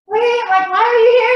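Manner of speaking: high, loud mock surprise; puzzled outburst, playing the neighbor; surprised question. Dog sitters puzzled at the gate, loud high surprise. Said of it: "And they're like".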